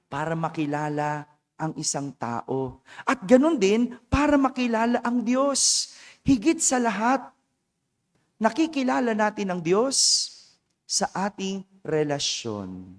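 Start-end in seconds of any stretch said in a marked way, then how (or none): none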